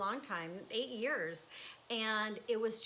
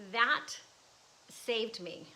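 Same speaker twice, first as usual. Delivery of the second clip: usual speaking voice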